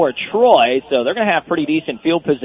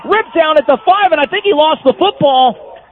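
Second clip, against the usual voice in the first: rising excitement, pitch climbing; excited play call; 9 semitones higher than usual. Real, not acted. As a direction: call the big run with rising urgency. Play-by-play of fumble run.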